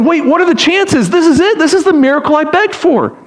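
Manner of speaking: high-pitched, amazed realization; excited, incredulous hope; astonished dad. Acted. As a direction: exclaim as a father whose miracle has come. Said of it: "Imagine being this dad".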